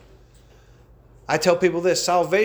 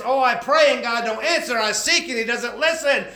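lightly whining, raised pitch, a sinner complaining to God; mock whining sinner; 6 semitones higher than usual. Preacher mimics sinner whining that God won't answer. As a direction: play the self-pitying sinner, half mocking. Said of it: "why the crying and whining?".

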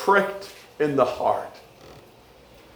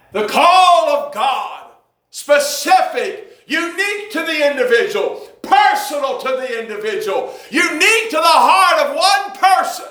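full-force preaching; 10 semitones higher than usual. Acted. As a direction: preach it with fire.

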